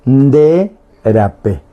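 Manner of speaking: de rapé, slowly, with a gap between the words; slow word-by-word model. Shown as a demonstration. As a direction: draw out de, then give rapé separately. Teacher models the phrase word by word. (de rapé, lento y palabra por palabra)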